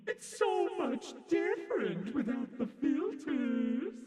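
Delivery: high-pitched, slow filtered voice. Real, not acted. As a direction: talk through a pitch filter. Testing voice filters.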